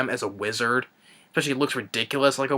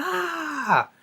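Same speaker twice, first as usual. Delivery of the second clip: over-the-top mock scream; 14 semitones higher than usual. Reviewer mocks the heroine screaming as she shoots, over-the-top mock scream. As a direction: send up an overdone movie scream. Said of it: "horrible, over-the-top screaming".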